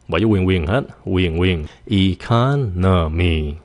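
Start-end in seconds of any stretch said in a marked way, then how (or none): 1.9-3.5 slow economy, CON stressed, ending stepping down; stress then falling tone. Shown as a demonstration. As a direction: stretch "Economy", e-CON-o-my, dropping after CON. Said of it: "xuống giọng".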